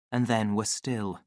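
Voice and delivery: usual speaking voice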